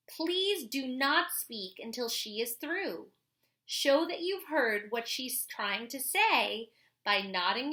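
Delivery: put-on clear, lively read-aloud voice for kids; reading a picture book aloud. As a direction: read it like a story for the class. Reads a children's book aloud.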